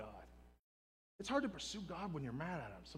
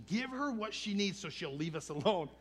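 raised, louder voice of fed-up disciples; exasperated demand; 4 semitones higher, 8 dB louder than usual. Retold or imitated. Fed-up disciples urging Jesus about the woman, exasperated. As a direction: demand it loudly, wanting rid of her.

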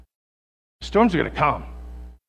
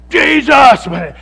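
desperate shout; 10 semitones higher, 17 dB louder, slower than usual. Acted. Peter crying out while sinking.

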